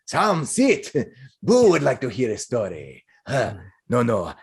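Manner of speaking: eccentric, hearty man in character, with chuckles; jovial role voice, fond of his pet; jolly hamster owner. Acted. Balding man introduces his hamster to the performer, jovial.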